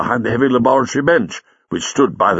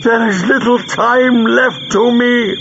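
high, slow, strained plea; villain's pained, high-pitched cry; croak; 13 semitones higher, 4 dB louder, slower than usual. A narrator's reading. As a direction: croak it out desperately, drawing out the words. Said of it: "he croaked".

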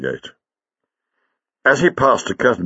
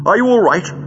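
high, louder worried question; anxious friend, pitch raised; 12 semitones higher, 5 dB louder, faster than usual. A narrator's reading. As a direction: ask with urgent concern. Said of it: "he asked anxiously".

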